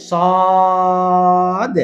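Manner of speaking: "সদ" with a thick s and long level vowel, bouncing off the d; very slow emphatic Arabic "সদ"; heavy, stretched letter. Shown as a demonstration. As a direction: hold "সদ" long and full, then release the final d. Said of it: "তাহলে টেনে বলতে হবে".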